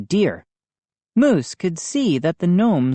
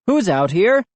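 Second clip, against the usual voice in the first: louder, higher call into the dark; 5 semitones higher, 3 dB louder than usual. A narrator's reading. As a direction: call across the yard. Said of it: "my father called".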